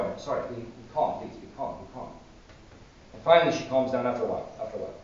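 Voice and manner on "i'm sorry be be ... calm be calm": very soft, fading into a whisper; hushed, tender plea for calm; gentle soothing. Retold or imitated. Saint holding the nun's hand, gently soothing her. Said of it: "holding her hand very gently, saying".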